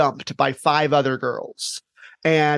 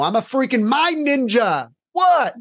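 higher cocky brag, ending in a high yelped what; playful boast; 8 semitones higher than usual. Retold or imitated. Teacher answering the friend's doctor question, mock cocky. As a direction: swagger like a proud superhero. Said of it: "And I'm like".

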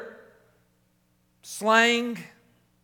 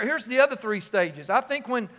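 ordinary talk, nothing marked

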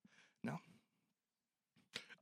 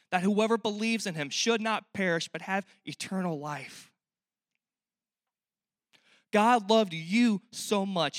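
slow, louder, higher proclamation; fervent preaching; 4 semitones higher, 6 dB louder, slower than usual. Acted. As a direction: proclaim the verse as the heart of the sermon.

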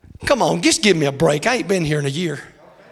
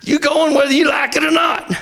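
preacher's shout; 11 semitones higher than usual. Acted. Preacher calls out to the congregation, shouting. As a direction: shout it out to the pews.

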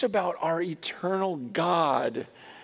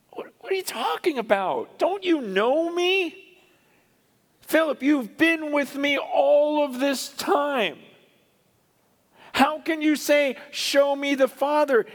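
incredulous; 10 semitones higher than usual. Retold or imitated. Jesus answering Philip who asked to see the Father, incredulous. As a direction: rebuke him in raised, astonished tones.

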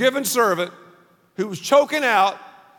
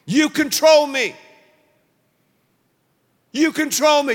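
emphatic repetition; 5 semitones higher, 4 dB louder than usual. Acted. Repeated declaration, building.

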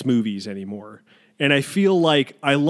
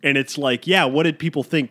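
normal talking voice

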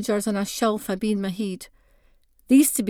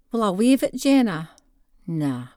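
ordinary delivery

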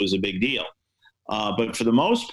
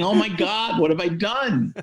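mock panic, much higher; a hammed-up oh-my-God, pitched high; retold panic; 8 semitones higher than usual. Reporter panicking as the girl bursts into tears, mock panic up high.

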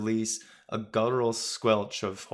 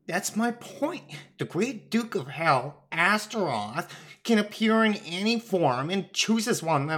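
higher, animated voice for a fussy talking quill; earnest floating feather; 8 semitones higher, 3 dB louder than usual. A narrator's reading. Feather god argues about a demon's disguise, high and earnest. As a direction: argue as an exasperated magical plume.

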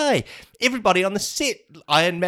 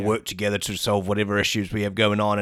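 Thanos; 8 semitones lower than usual. Acted. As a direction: put on a big low Mad Titan voice. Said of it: "interview with Robert Downey Junior and Thanos".

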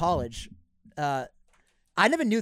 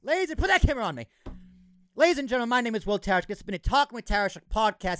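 higher and louder, hyped host announcement; announcer voice; 6 semitones higher, 4 dB louder, faster than usual. Acted. Host signs off the podcast, big announcer voice. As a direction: announce it like a showman.

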